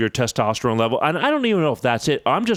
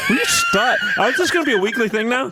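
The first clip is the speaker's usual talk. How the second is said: high, fast, laughing through the words; laughing protest; 12 semitones higher than usual. Real, not acted. To the teasing co-host, a laughing protest. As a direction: protest while cracking up.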